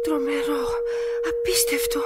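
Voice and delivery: breathing out her shock, barely voiced at first; appalled murmur to herself; horrified hush. Woman stunned after overhearing murder plot.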